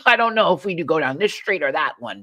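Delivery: laugh, then a lightly voiced confused driver; a hint of a bewildered driver asking Bill; mock dithering driver. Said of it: "four-way stop, you know".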